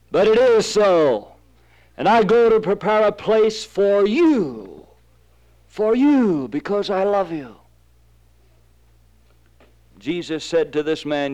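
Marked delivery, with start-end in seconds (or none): 0.1-7.5 high, drawn-out, fervent assurance; fervent promise. Acted. Jesus promising his disciples a place, fervent. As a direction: promise it slowly and with conviction.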